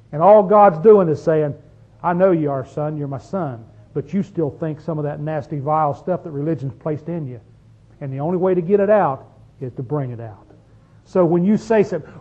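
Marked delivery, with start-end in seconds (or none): 2.0-10.5 softer, lower, tender reassurance; soft reassuring father; gentle voice of God. Retold or imitated. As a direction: speak as a loving father to his son. Voicing God speaking gently.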